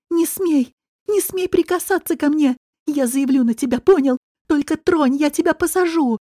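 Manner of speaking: high, urgent, indignant protest; alarmed warning. Acted. Woman threatens to report her attacker, alarmed and defiant.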